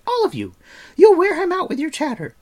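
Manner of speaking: high, loud shooing cry; cried out. A narrator's reading. Girl drives the visitors from the sickroom, loud and bossy. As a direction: chase them all out sharply. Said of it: "she cried".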